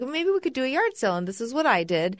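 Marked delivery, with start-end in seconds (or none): 0.0-1.2 bright suggestion. Retold or imitated. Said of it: "I was like".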